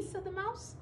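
plain reading voice

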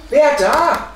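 loud, high challenge; sudden sharp cry into the dark; calling out. A narrator's reading. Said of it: "rief er".

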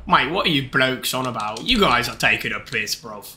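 put-on low British bloke accent. Streamer teasing viewers, low British accent. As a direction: scold them like a British lad. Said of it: "My accent is absolutely class".

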